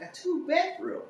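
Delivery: high, mock-proper phone voice.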